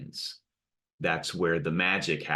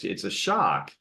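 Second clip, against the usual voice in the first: ordinary delivery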